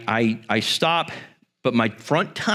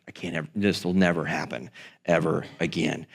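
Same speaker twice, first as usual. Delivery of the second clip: much lower and softer, to himself; quiet inner vow; 10 semitones lower, 6 dB quieter than usual. Retold or imitated. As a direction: murmur a guilty promise.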